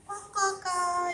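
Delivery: drawn-out playful crow calls; crow caw imitation. Shown as a demonstration. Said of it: "It make noise".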